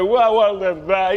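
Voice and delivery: loud, high mock wail; hammed-up crying, pitched high; imitated bawling. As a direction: imitate yourself bawling, for laughs. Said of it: "bawling and crying".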